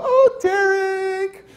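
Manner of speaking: high, drawn-out "aww" reaction; fond exclamation. Retold or imitated. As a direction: coo it up high and slowly. Social worker's fond reply, acted.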